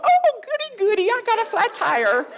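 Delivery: very high-pitched gleeful squeal; hammed-up glee over bad news; mock delight. Mock delight at a flat tire.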